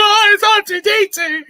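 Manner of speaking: high, delighted squeal of recognition; overjoyed fan squeal; excited squeal. Retold or imitated. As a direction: shriek it like a thrilled fan spotting an old friend. Excitedly reliving seeing R2.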